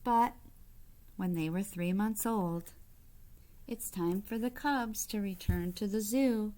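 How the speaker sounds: bright, lively storybook reading; reading to children. A narrator's reading. Reading a picture book aloud to kids, lively storybook voice. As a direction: read it out to the little ones with gentle delight.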